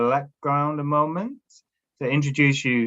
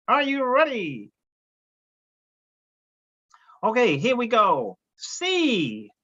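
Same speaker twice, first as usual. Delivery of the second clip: puppet voice; 8 semitones higher, slower than usual. Pretend. Woody the puppet leads drill.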